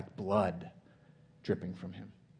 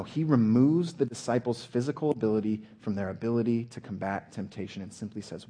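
ordinary delivery

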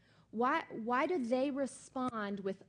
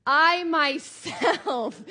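"I myself" very loud, higher and drawn out; emphatic I myself; 5 semitones higher, 12 dB louder, much slower than usual. Shown as a demonstration. Preacher echoing the toddler's emphasis, hammering "I myself". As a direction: belt out "I myself", stretching each word. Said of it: "that really gets across that emphasis".

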